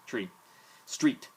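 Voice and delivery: ordinary delivery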